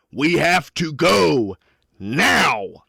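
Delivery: slow, high, loud command to flee; urgent shout. Acted. Miner holds you by the lapels, shouting urgently. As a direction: draw out each word in a loud, urgent cry.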